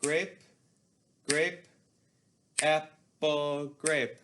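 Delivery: grape, grape, apple, grape called out on the beat; rhythm demo. Shown as a demonstration. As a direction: demonstrate the rhythm clearly for students. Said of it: "it would sound like this".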